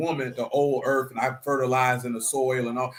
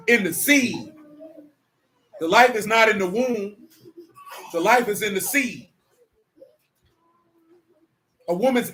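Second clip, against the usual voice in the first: raised, louder preaching cadence; 5 semitones higher, 5 dB louder than usual. Acted. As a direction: drive each point home like a sermon. Preaching the seed teaching.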